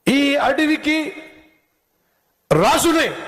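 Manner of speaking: high, slow proud claim; boastful fox. Acted. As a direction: drag it out, crowing that he is king.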